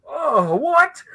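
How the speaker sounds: very high-pitched astonishment; starstruck disbelief. Retold or imitated. Fan after a celebrity's friendly goodbye, astonished. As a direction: act out being stunned, voice leaping up.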